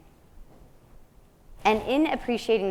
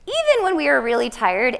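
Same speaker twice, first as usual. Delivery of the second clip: usual speaking voice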